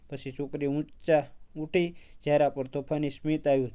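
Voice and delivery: usual speaking voice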